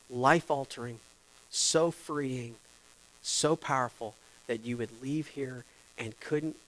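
normal talking voice

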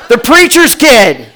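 high, drawn-out loud tattling; accusing classmates. Retold or imitated. Whole class turning on him, mock accusing shout. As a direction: sing out the culprit in mock accusation. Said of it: "My entire class turned on me".

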